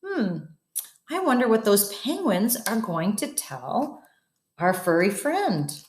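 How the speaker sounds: ordinary delivery, nothing marked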